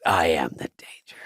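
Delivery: low, whispery menace impression; Breaking Bad quote. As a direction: imitate a menacing husband, hushed and low. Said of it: "And he goes".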